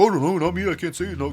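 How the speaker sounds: babbled put-on voice protesting innocence; mock flustered denial. Wrestlers denying fault for the match, mock flustered babble. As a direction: run the excuses together in a silly voice.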